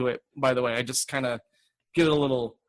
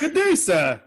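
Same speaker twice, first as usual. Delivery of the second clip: high, laughing gentleman voice; high mock gentleman; 9 semitones higher, slower than usual. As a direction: squeak out the old-timey goodbye.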